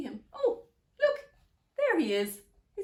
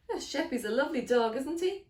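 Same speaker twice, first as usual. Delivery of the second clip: ordinary delivery, nothing marked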